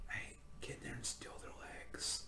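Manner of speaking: urgent whisper. Pretend. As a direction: whisper a quick order. Sneaking party whispers.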